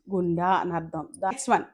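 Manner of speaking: normal talking voice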